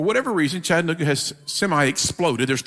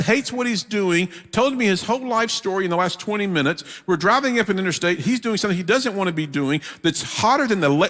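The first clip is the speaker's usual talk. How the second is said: ordinary talk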